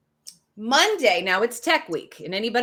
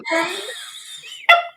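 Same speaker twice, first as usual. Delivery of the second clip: high burst of real laughter; a big real laugh at the joke; loud laugh; 5 dB louder than usual. Real, not acted. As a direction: crack up loudly. Laughing at eyeball.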